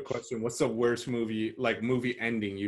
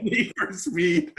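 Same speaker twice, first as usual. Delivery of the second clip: higher, louder, slowed incredulous echo; amused disbelief; 8 semitones higher, 6 dB louder, slower than usual. Real, not acted. Friend echoing the movie title in amused disbelief. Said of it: "Fast and".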